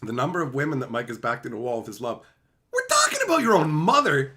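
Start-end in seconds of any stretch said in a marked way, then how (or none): 2.7-4.3 high, loud mock outrage; mock outraged. Real, not acted. To chat about his mom, mock scandalized high shout.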